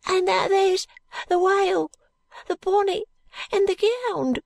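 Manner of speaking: put-on high, thin ragged boy; street urchin voice. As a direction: speak like a timid street boy.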